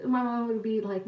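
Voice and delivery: ordinary talk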